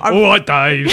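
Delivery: loud, laughing mock Cockney accent; Cockney Hal. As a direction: bellow it in a jokey Cockney accent. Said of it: "this Cockney Hal".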